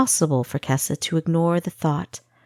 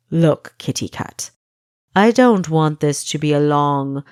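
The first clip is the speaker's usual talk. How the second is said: louder, smooth threatening Pastry Cook; a calm captor pressing a prisoner; menacing interrogator; 4 dB louder than usual. Acted. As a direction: lean in and press her for the name.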